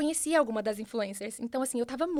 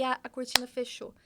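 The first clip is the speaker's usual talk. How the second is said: ordinary talk, nothing marked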